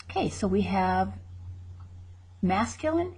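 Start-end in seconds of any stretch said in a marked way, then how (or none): none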